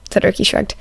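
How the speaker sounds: ordinary delivery, nothing marked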